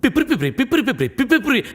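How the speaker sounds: rapid puffed "pipri pipri" toots; mouth organ imitation. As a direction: mimic a harmonica's quick bursts. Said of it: "ఏమంటున్నాడు రా వాడు?".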